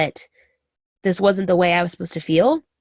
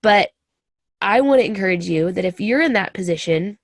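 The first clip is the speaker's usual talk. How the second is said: ordinary delivery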